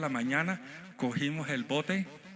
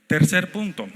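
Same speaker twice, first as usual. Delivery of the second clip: loud announcement; 4 semitones higher, 12 dB louder than usual. Real, not acted. Preacher announces next point loudly.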